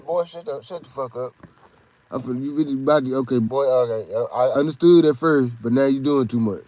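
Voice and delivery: put-on a dad fed up with a crying kid, muttered low; dad telling him off. Dad snapping at crying son.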